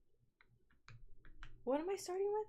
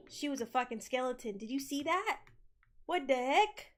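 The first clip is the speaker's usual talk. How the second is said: ordinary talk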